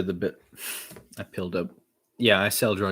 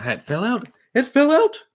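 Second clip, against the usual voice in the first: incredulous cry, jumping very high and loud; startled outburst; 10 dB louder than usual. Real, not acted. Interrupted mid-rap as it falls out, real exasperated outburst. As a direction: break off in high, loud disbelief.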